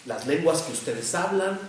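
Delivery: normal talking voice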